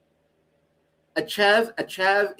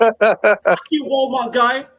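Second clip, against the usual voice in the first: mock yell; 5 semitones higher, 5 dB louder, much slower than usual. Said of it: "Just yelling".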